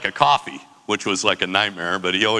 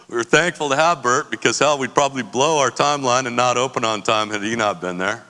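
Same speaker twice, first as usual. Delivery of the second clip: ordinary talk, nothing marked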